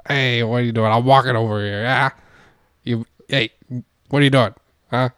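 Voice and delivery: put-on New York street accent; brash New Yorker impression. Shown as a demonstration. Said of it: "Give me your best New York accent".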